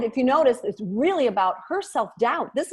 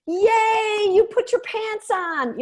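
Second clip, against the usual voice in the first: hammed-up cheerleader, higher and slower; mock cheery; 10 semitones higher, 3 dB louder, much slower than usual. Shown as a demonstration. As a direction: overdo the cheer for a tiny win. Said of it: "you don't wanna come to every call all chipper and cheery".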